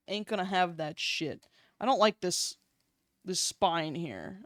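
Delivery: ordinary talk, nothing marked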